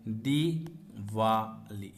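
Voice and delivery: दिवाली drawn out very slowly, long ई clear; teacher slowly sounds out दिवाली; slow spelled word. Shown as a demonstration. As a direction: stretch दिवाली to show the long vowel. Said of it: "बड़ी की मात्रा लगती है".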